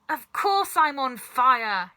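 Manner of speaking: grandma yelling that she's on fire; yelled. A narrator's reading. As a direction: bawl it back at him. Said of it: "she yelled".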